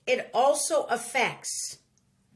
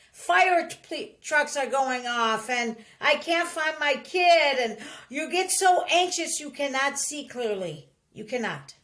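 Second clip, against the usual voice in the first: usual speaking voice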